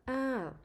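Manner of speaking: "ah" starts high then falls down; falling tone ah. Shown as a demonstration. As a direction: lift "ah" high and let it drop. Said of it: "The voice goes up and then back down".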